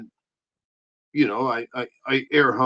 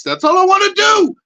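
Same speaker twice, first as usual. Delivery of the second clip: high, loud puppet shouting a defiant rant; 9 dB louder than usual. Acted. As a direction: rant as the cocky pep puppet.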